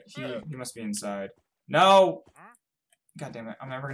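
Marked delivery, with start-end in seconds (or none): 1.7-2.2 frustrated shout. Real, not acted. Hit the wrong button.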